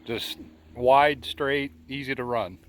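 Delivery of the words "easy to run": ordinary talk, nothing marked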